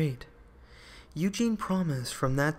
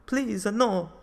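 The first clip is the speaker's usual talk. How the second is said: a high, loud, panicked plea; alarmed cry; 7 semitones higher, 5 dB louder than usual. Acted. Sculptor begging the giant to spare his work, panicked cry. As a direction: cry out to stop him, frightened.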